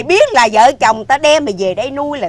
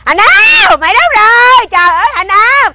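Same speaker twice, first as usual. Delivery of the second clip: high loud call; yelling a name; 6 semitones higher, 4 dB louder than usual. Acted. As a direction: shout across the yard for him. Wife yelling for the boy. (gọi lớn tìm con)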